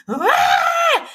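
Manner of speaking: high piercing mock tantrum scream; toddler tantrum scream. Mum imitating her son's tantrum scream, high and piercing. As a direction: shriek like a toddler losing it. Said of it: "he has a full-blown spac attack".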